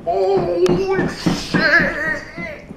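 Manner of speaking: very high, stretched-out mock exclamation; drawn-out high gasser-upper; hyped-up admirer. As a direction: imitate a hype man in squealing falsetto.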